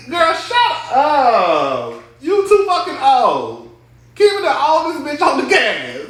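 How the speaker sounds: high, loud, drawn-out mock scold; loud teasing. Real, not acted. Vlogger teasing Kimmy for crying, loud high mock scold. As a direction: yell it at the screen, teasing.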